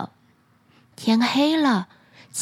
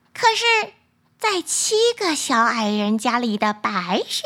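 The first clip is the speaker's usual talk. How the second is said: shimmering echoing mirror voice; 6 semitones higher than usual. Acted. Magic mirror answers queen.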